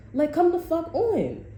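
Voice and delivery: high, slow come the fuck on; incredulous scoff. Real, not acted. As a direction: stretch it out in disbelief. Incredulous mockery.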